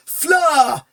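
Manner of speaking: yelling to his love at the door; wild yell. Acted. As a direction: call out wildly across the hall. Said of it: "he yelled wildly".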